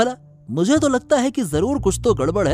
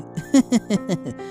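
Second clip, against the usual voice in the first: scheming chuckle; 3 dB quieter than usual. Acted. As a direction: chuckle to yourself over a plot. Schemer chuckles over his plan. (चालाकी भरी हँसी)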